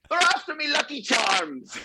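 very high-pitched, hammed-up cartoon Irish; lame Irish accent. Shown as a demonstration. Actor demonstrating his bad phone accent for the interviewer, lame Irish accent. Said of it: "a lame attempt at some Irish accent".

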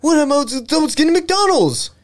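a caught man's high flustered excuse to the host; squeaky caught-guy voice. Retold or imitated. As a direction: squeak it out like a busted man playing dumb. Mimicking arrested guy's excuse.

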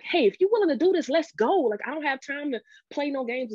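rally her like a coach before a game; pep talk. Retold or imitated. Husband pep-talking his wife on the phone, pumped up and fast. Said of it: "almost a pep talk".

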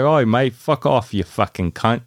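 hammed-up British "oi mate" insult; British accent. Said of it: "It's the accent".

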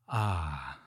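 low, soft, soothing "ah"; a gentle, knowing sound of comfort. A narrator's reading. Wise voice comforting the girl who stopped herself, soothing. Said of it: "came the soothing voice".

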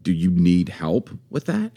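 slow, loaded offer of help; slow, condescending offer; pointed question. Shown as a demonstration. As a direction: draw the question out doubtfully. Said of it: "just how you say it changes".

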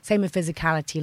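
ordinary talk

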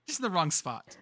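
ordinary delivery

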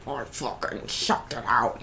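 hushed gloat. Retold or imitated. Triumphant inner voice after sex.

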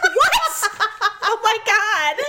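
high, loud laughing shock. Retold or imitated. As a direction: react as if insulted by the question. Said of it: "You're like".

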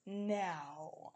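low, slow, creepy podcast voice; deep creepy voice. Retold or imitated. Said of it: "this crazy, like, deep voice".

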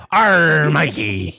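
loud pirate arr; pirate greeting. Pretend. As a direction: growl his name like a pirate. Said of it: "I mean, not yo. Arr".

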